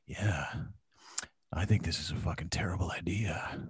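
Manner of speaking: low, whispery radio-DJ murmur; lightly mocking a calm rock DJ; Jeff Woods's deep breathy voice. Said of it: "he would be like".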